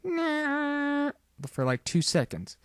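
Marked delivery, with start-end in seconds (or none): 0.0-1.1 high, soft sustained jingle note; imitated logo sound. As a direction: mimic a short TV sting on one high note. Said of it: "it just came on quick, like".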